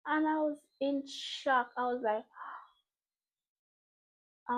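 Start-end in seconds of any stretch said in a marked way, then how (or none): none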